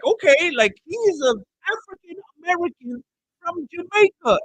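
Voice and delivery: high, insistent condescending imitation; mock patronizing. Imitating a lady explaining a coworker's identity, patronizing. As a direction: correct him as if he knows nothing, high-pitched.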